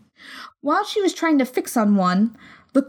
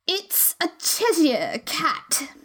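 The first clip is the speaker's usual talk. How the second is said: slow, drawn-out lofty lady; haughty duchess; 5 semitones higher, 3 dB quieter, much slower than usual. A narrator's reading. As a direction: look down your nose at her. Duchess voiced by narrator.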